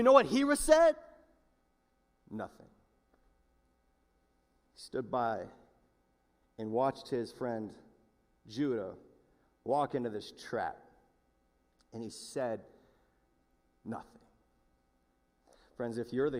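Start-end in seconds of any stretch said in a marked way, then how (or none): 2.3-14.2 low, soft, with long pauses; hushed, grave. Real, not acted. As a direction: drop to a quiet, heavy hush for the silence. Hushed drop after the shouting.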